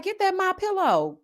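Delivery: lightly voiced grandma, high.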